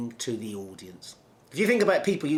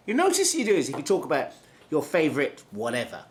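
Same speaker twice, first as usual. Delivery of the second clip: ordinary talk, nothing marked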